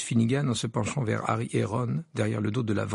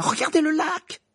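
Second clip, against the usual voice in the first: much higher, louder cry; shouting; 5 dB louder than usual. Acted. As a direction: call everyone to look. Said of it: "s'écria Lee Jordan".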